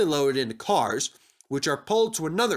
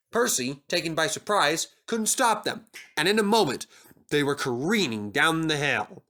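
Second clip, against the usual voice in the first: usual speaking voice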